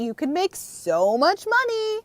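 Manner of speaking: high, stretched-out excited rise. Real, not acted. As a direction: draw it out with rising delight about the money. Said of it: "I'm really excited about the money".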